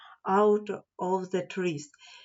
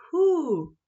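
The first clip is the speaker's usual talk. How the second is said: high, loud hoot; owl hoot; 6 semitones higher, 4 dB louder than usual. Acted. Owl hoots.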